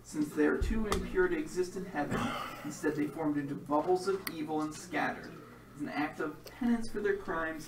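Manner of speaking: dramatic game master narration; story narration. A narrator's reading. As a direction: set the scene like a storyteller.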